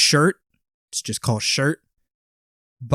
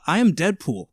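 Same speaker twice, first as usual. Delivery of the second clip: ordinary talk, nothing marked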